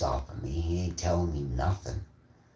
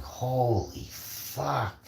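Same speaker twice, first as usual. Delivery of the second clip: slow, drawn-out, higher exclamation; mock amazement; 7 semitones higher, much slower than usual. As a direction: stretch out both words in disbelief. Acted amazed reaction.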